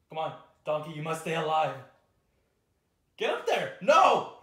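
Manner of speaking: loud high urging yells; rally the donkey, frantic; urgent shouting. Real, not acted.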